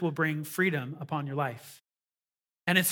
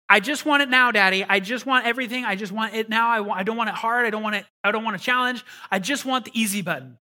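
put-on whiny child; 3 semitones higher, 4 dB louder than usual. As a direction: beg like a kid who wants it all now. Whiny child asking Daddy.

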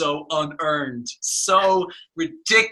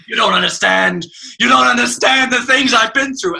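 sudden melodrama; 6 semitones higher, 5 dB louder than usual. Retold or imitated. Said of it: "the tone completely switches".

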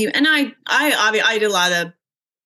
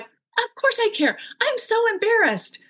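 retold embarrassed daughter. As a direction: voice the daughter defending herself, high and small. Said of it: "she looked at me like".

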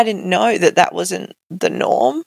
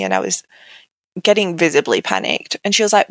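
ordinary delivery

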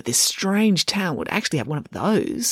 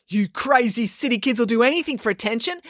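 accusing; 6 semitones higher than usual. Acted. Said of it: "accused Animesh".

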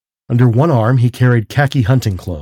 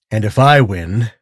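plain reading voice

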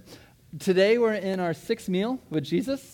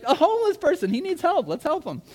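high, loud, bursting with excitement; excited; 12 semitones higher, 4 dB louder than usual. Retold or imitated. Rushing into social services to tell the worker, high excited shouting. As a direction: blurt it out like you just won. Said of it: "and I'm all excited".

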